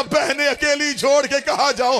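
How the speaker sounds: high-pitched grieving protest; tearful question. Acted. Hussain unwilling to leave his sisters, tearful lament. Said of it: "रो के दे".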